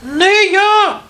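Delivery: very high squeaky mouse voice; mouse calling out. A narrator's reading. Mouse refuses to go to the mill, squeaky call. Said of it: "гукає верть".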